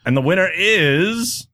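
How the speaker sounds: slow, high awards build-up; announcer voice. Shown as a demonstration. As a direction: drag it out before the reveal. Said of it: "my announcer voice".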